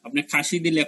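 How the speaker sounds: ordinary talk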